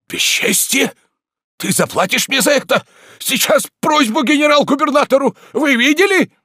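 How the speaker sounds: very high, loud indignation; outraged yelling. Acted. Tarantyev threatens a complaint over the slap, outraged yelling. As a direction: cry out for justice and witnesses. Slapped man outraged, calls witnesses.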